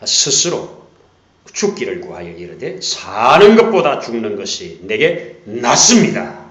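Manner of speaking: loud, forceful scripture reading; preaching at full force. Acted. Preacher booming Jonah's words to the church. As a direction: proclaim it to the congregation.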